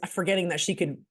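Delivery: ordinary talk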